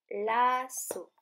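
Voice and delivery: "lasso" with a long first syllable, falling to close. Shown as a demonstration. Teacher shows children the shared ending, "lasso" split in two. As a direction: hold la, then set down so in "lasso". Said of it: "la dernière syllabe".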